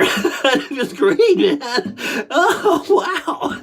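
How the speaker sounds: real laughter bubbling through drawn-out words; slow, elated, cracking up; giddy relief. Real, not acted. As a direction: rise into a high, laughing exclamation.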